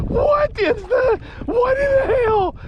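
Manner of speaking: shouting, half laughing in shock; disbelieving shouts. Real, not acted. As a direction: yell in pure disbelief at the fish. Stunned by fish's size.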